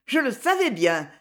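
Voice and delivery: usual speaking voice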